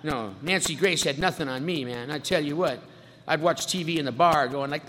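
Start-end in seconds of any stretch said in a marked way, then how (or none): none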